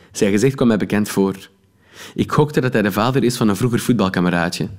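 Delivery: plain reading voice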